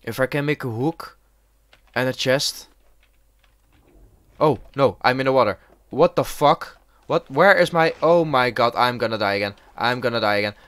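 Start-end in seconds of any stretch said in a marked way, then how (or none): 4.4-10.5 loud, frantic, raised voice; alarmed panic. Real, not acted. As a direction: blurt it out in rising alarm. Fallen in water with shark.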